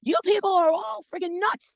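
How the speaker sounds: high, slow mock declaration; imagined outburst. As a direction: spell it out high and slow like telling off a crowd. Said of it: "I can't just stand up and say".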